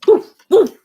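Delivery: high, loud playful woofs; mock bark. Owner barking on the dog's behalf, high mock woofs. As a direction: bark for the dog.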